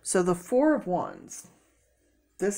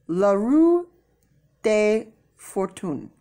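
playful French-ish accent, stretched out; unidentifiable foreign accent; 2 semitones higher, 4 dB louder, slower than usual. Shown as a demonstration. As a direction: ham up a vague French-style accent. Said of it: "I don't know what accent that is".

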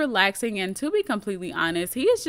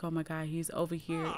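much softer, hushed, panicked Oh my God; hushed panicked thought; 3 semitones lower, 10 dB quieter than usual. Acted. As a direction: murmur it under your breath in a panic. Marie's panicked inner thought.

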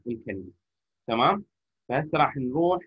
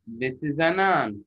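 slow, words run together as one; linked smoothly; much slower than usual. Shown as a demonstration. Teacher repeating the linked phrase for pupils, slowly. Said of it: "فشو نقول؟".

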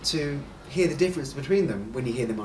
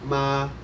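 "ma" held on one high, level pitch; first tone. Shown as a demonstration. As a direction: say "ma" in Mandarin first tone, flat with no rise or fall. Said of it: "keep the pitch high and flat".

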